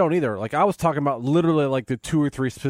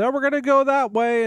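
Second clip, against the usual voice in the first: casual excuse; 10 semitones higher than usual. Retold or imitated. Acting out excuse to slip away.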